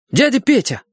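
high-pitched startled yell. A narrator's reading. Boy spotting the cook coming from the kitchen, yelling. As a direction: call out urgently, pitch right up. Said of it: "заорал я".

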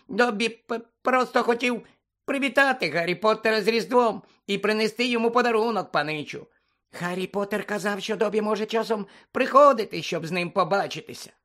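high-pitched, pleading creature voice; anxious squeaky elf. A narrator's reading. House-elf explaining his Christmas visit to Harry, nervous squeak. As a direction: stammer the excuse in a high squeak. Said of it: "злякано запищав Добі".

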